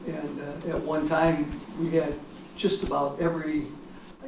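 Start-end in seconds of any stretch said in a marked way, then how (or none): none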